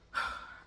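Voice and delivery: audible sigh; short unvoiced sigh. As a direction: let out a breath before going on. Pauses amid painful school memories.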